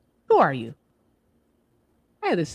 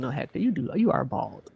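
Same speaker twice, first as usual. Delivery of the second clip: ordinary talk, nothing marked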